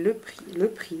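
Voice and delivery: hushed whisper building suspense. Acted. Teasing viewers before the price, in a whisper. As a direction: whisper it like a secret drumroll.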